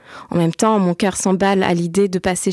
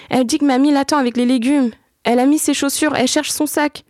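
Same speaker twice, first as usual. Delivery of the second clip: lightly voiced child, higher; small boy urging his sister home; a hint of an anxious child, higher; worried little boy; 6 semitones higher than usual. A narrator's reading.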